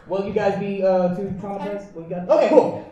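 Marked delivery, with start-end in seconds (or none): none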